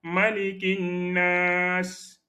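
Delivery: Malikin Nas drawn out slowly, the L dropped and the N doubled; very slow correct Malikin Nas. Shown as a demonstration. Teacher spelling out the correct form very slowly. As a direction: stretch each syllable of Malikin Nas. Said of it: "Donc, c'est".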